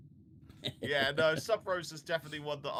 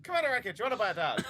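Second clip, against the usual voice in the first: mock Irish traveller; 6 semitones higher, 5 dB louder, much faster than usual. Mocking traveller colonist selling to Riker.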